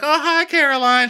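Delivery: high, loud sing-song hi; mock Chanel greeting. Mimicking Chanel greeting Caroline, high and loud. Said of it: "She's like".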